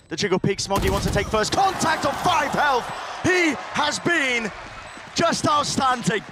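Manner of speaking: esports caster. Real, not acted. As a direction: savour the big play. Hype call of a player.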